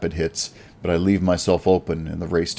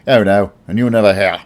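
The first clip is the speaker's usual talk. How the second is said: louder roguish snap; louder, curt gangster warning; snapping; 3 semitones higher, 8 dB louder than usual. Acted. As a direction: bite the words off with a threat. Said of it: "he snaps at me".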